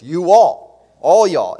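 you all, all y'all called out loud with Southern flavour; emphatic you all. Real, not acted. Preacher to congregation, loud emphatic all y'all. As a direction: raise the voice and punch all y'all. Preacher driving the point home.

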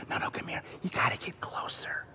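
unvoiced coaxing whisper. Dad coaxing his child closer, playful whisper. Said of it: "and you say".

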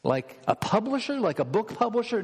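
incredulous, high rising questions; puzzled listener. Acted. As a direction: query it in disbelief, pitch jumping up. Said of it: "You say, "What?".

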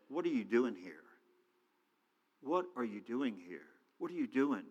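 soft, hushed question repeated; still small voice. Acted. God speaking softly to Elijah, hushed. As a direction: ask it gently as the still small voice. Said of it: "the voice said".

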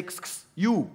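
"Kss, kss" said as sharp hissed clicks before a curt "you"; hissing kss call. Shown as a demonstration. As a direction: call someone rudely with a hiss. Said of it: "In my country, we say".

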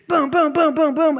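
loud, high booms like punches landing; pounded booms, hammed up; mock impact sounds. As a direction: hammer out each boom. Imitating the blows landing.

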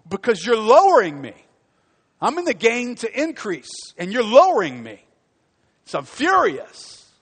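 a preacher acting out wounded fury; furious indignation. Voices the furious defensive person.

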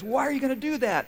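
high, anxious question; alarmed patient. Retold or imitated. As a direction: play the frightened patient. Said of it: "And he goes".

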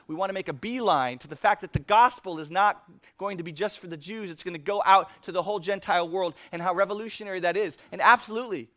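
loud, fast sermon delivery; full-force preaching. Real, not acted. Pastor urging the congregation: full-force preaching. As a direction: press the point hard to the room.

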